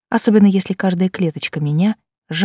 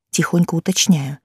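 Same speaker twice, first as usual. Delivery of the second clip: ordinary delivery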